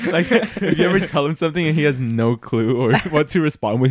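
normal talking voice

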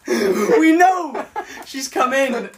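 high, loud shout through laughter; laughing exclamation. Real, not acted. Co-host teasing a friend who keeps repeating, laughing. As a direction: burst out laughing as you shout it.

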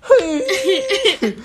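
high wailing mock howl, laughing; dog's howl imitated. Imitating the dog yelling at her, high laughing wail. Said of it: "She literally was like".

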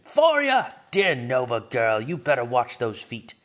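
stern fatherly yell from the kitchen; loud stern dad yelling up the stairs. A narrator's reading. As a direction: call her out loud and stern, half teasing. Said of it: "Dad yelled from the kitchen".

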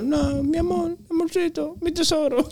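soft, high sweet-talking; tender lovey voice. Retold or imitated. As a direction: coo it like to a lover. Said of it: "cuando estoy en amor".

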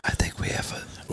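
unvoiced hushed whisper. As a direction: breathe it with no voice. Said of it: "I'm whispering".

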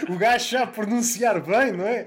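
voz afetada e arrastada, mais aguda, de sabichão; pedante imitado. Acted. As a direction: faz de gajo que pronuncia tudo bem. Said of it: "para não parecer". (imitação lenta de um pedante)

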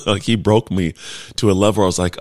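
normal talking voice

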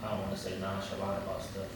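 quiet, low monotone; flat, unbothered murmur. Real, not acted. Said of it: "As you speak in a monotone volume".

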